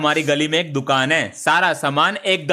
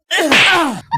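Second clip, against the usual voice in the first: loud warning cough; 13 semitones higher, 4 dB louder than usual. Acted. Principal coughs to announce himself.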